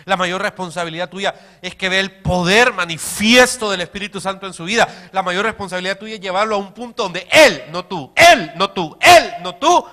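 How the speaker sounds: loud, driving preacher's build; preaching at full force to the congregation. Acted. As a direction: hammer it home from the pulpit. Preacher builds to emphatic peak.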